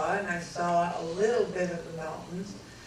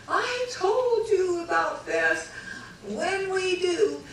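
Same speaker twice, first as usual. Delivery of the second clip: agitated retelling; 11 semitones higher, slower than usual. Retold or imitated. Arguing with pilot mid-flight.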